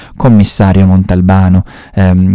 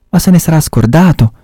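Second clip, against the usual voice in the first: near shout; 9 semitones higher than usual. Acted. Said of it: "fece Montalbano e quasi gridò".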